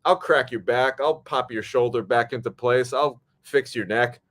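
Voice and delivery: ordinary talk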